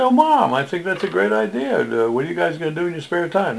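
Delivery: son's voice pitched well up, cheerful; high son's voice. Retold or imitated. He voices the son.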